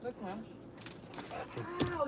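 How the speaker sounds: usual speaking voice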